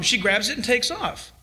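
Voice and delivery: ordinary delivery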